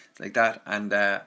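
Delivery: ordinary delivery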